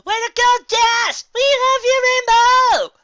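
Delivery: high, loud faked cheering crowd; imitated crowd roar. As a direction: cheer for yourself like a stadium. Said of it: "the roar of replying was".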